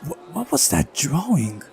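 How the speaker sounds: startled high whisper. Real, not acted. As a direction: caught off guard, squeaking. Startled by the drawing.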